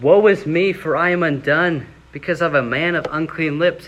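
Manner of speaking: dramatic lament. Acted. Preacher voicing Isaiah's woe to the congregation, loud lament. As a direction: cry it out like a prophet undone. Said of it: "then I said".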